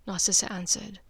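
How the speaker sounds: ordinary delivery, nothing marked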